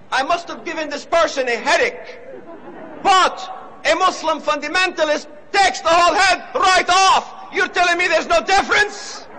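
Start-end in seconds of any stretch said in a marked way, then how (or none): 3.0-9.3 raised, higher-pitched oratorical shout; indignant shouting. Real, not acted. Preacher rebutting the interviewer before a crowd, indignant shout. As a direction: build to an incredulous, forceful challenge.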